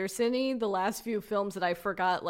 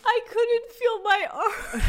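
laughing; 12 semitones higher, 5 dB louder, slower than usual. Real, not acted. Laughing at own stoned drive.

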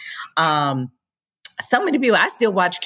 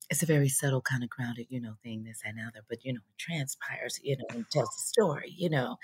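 very soft, low, near-whispered mimicry; imitated subtle underplaying; 5 semitones lower, 11 dB quieter than usual. Comedian mimicking grounded young castmates, hushed and low. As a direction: drop way down, murmur it like an understated actor.

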